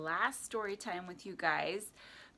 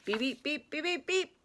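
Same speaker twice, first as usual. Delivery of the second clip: high little horn toots; truck beeps; 8 semitones higher than usual. A narrator's reading. Little Blue Truck driving off with friends, high beeps. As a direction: beep cheerfully, climbing higher.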